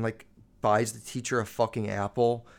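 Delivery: usual speaking voice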